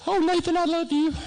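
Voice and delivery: mother on the phone telling her son he's the favorite; gushing mother. Retold or imitated. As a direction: imitate mom suddenly doting on her son. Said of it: "she goes".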